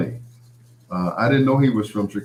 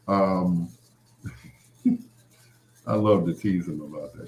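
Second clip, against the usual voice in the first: usual speaking voice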